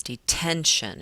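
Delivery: detention with TEN punched and stretched; stress on middle syllable. Shown as a demonstration. Teacher showing correct stress to class, TEN emphasized in detention. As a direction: lean hard on the ten in detention. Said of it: "I put that stress on that middle syllable".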